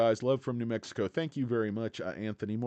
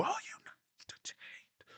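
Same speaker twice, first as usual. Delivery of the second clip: breathy unvoiced whisper, high at the start; teasing whisper; 12 dB quieter than usual. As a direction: whisper it as a sly aside to viewers. Teasing viewers who want entertainment.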